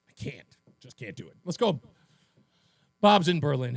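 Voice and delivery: usual speaking voice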